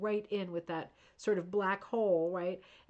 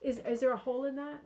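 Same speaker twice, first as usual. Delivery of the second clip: tender; 6 semitones higher than usual. Real, not acted. Comforting pet after cat caught mouse.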